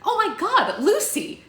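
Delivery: high-pitched loud scream of shock; very high, loud outburst; horrified yell. Acted. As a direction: shriek at your friend in alarm. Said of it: "Tangerina yelled".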